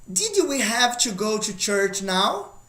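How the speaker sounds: slow, careful English question; slow model question. Shown as a demonstration. Teacher modelling a past question slowly. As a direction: read the example slowly for the class.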